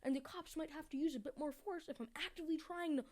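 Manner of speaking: worked-up scolding; heated rant. Real, not acted. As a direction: scold her, voice climbing. Ranting at the woman.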